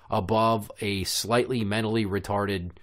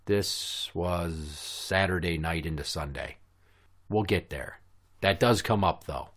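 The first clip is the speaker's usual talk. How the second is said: ordinary talk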